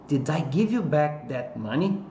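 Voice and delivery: "money" lifted at the end of the question; slow model question, raising the tune on "money"; rising question intonation. Shown as a demonstration. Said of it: "Ergue a entonação lá no final".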